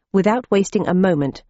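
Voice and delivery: ordinary delivery, nothing marked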